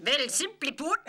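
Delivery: high, loud start breaking into laughter; cracking up. Real, not acted. Actor starting a reading, cracking up. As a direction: start the line and lose it laughing.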